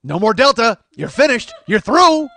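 high, very loud mock verdict; hammed-up expulsion shout. Dean throws the fraternity out, hammed-up shouting. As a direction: bellow each phrase as a final sentence.

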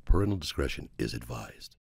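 announcer voice. Acted. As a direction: read it like a TV disclaimer. Mock disclaimer announcement.

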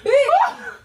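high, loud laughing shriek of disbelief; laughing outburst. Real, not acted. Woman finding her hair pranked, shrieking through laughter. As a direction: burst out in a shocked, laughing yell.

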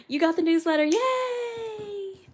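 high, long held "yay"; high-pitched celebrating; excited cheer. Real, not acted. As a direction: squeal a happy, drawn-out cheer. Cheers viewer getting newsletter.

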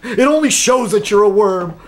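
high, fast sneering mimic with a laugh; mock voice of the angry troll; mocking imitation. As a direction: lampoon a petty troll, laughing.

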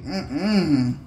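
fed up, putting a foot down; acted weary defiance, punching the key claim; fed-up refusal. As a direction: voice someone who has had enough. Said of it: "somebody definitely is like".

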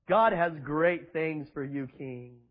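lightly mock the smooth flatterer. Preacher mocking a flattering pastor, slow and low. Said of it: "smiling like [name]".

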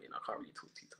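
very soft, almost swallowed aside; confidential whisper. Real, not acted. Guest hedging to the host about what he can't reveal, confidential whisper. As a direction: drop to a hushed, unvoiced-sounding whisper.

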